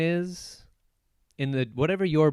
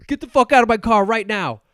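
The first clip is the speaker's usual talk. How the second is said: loud, higher shouted rant; hammed-up angry yell; 7 semitones higher, 9 dB louder than usual. Hero shouting at the woman who shot at him, hammed-up fury. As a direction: yell it like you're bailing out of the car. Acting out the angry car scene.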